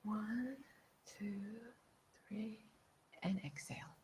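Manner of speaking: very soft breath count, almost whispered; meditation-style counting; hushed breathing count. Shown as a demonstration. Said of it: "So here we go".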